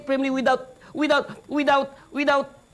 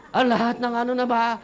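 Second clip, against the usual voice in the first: ordinary talk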